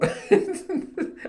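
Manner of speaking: airy giggle; quick breathy chuckle; breathy high laugh. Real, not acted. Laughs at own joke.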